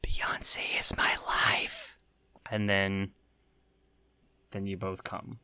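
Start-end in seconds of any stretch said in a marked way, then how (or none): 0.0-2.0 breathy seductive whisper, slow and high; hushed slow mock-seductive whisper. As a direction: lean in and whisper it slowly. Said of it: "you whisper in his ear".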